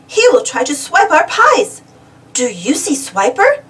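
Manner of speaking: usual speaking voice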